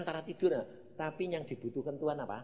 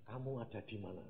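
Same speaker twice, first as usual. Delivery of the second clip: soft, lower question; retold divine question; 4 semitones lower, 11 dB quieter than usual. God questions the drowsy preacher, quietly retold. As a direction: ask it quietly, gently falling at the end. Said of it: "Lalu ditanya".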